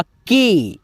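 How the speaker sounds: "के" with a long, held ए, falling at the end; demonstrated vowel. Shown as a demonstration. Teacher showing students how ए sounds on क, drawn-out "के". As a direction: stretch the vowel of "के" slowly. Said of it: "आपको बोलना होगा".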